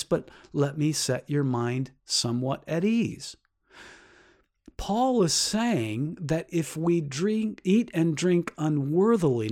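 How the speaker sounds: ordinary talk, nothing marked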